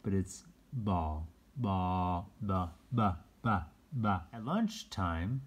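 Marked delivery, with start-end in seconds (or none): 1.6-4.2 "ba" repeated as a letter sound; "Ba" said over and over. Shown as a demonstration. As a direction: tap out the b sound. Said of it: "sounding out the letter".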